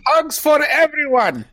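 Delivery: high, slow, gleeful cry; excited cheer. Acted. Player cheering that everyone gets hugs. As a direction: cheer it out to the whole party.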